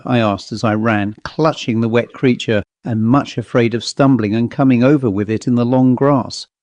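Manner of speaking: plain reading voice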